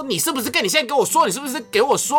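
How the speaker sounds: mock demanding mom. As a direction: corner him like an interrogating mom. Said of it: "他就说". (模仿妈妈逼问)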